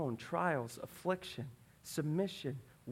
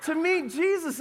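usual speaking voice

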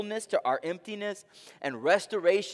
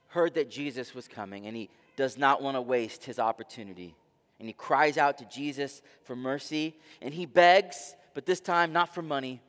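ordinary talk, nothing marked

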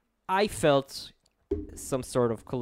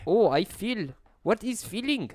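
mock German accent; 9 semitones higher than usual. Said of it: "how does one do a German accent".

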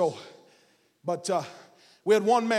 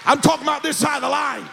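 impassioned, raised pulpit shouting; full-force preaching; 4 semitones higher than usual. Real, not acted. Preacher tells congregation they are blessed, not cursed, full-force preaching.

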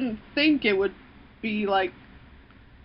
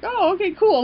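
high, bright, sarcastic okay cool; mock enthusiasm; 10 semitones higher, 3 dB louder than usual. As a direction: feign cheerful acceptance. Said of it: "you're just like".

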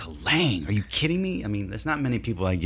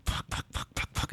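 whispered, softer string of curses; frustrated under breath; 10 dB quieter than usual. Retold or imitated. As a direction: curse to yourself, barely voiced.

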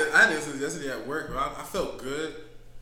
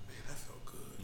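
relieved; 5 semitones lower, 16 dB quieter than usual. Retold or imitated. Retold relief after talking.

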